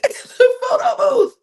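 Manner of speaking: high laughing echo of the phrase; laughing repeat. Acted. Vlogger laughing at the reality show moment. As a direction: laugh it out in disbelief.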